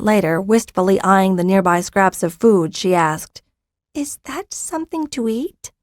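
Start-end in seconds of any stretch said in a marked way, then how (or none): 3.9-5.7 high, hushed hungry hatchling; baby dragon voice. Acted. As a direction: softly ask like a newborn dragon. Newborn dragon asks for food.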